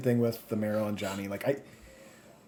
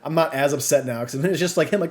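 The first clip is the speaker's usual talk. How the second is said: ordinary talk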